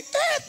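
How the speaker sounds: high-pitched imitated bleep; censor beep. Preacher bleeping out a worldly person's swearing, high censor beep. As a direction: squeak out a sustained censor beep. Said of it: "Terlalu banyak sensor".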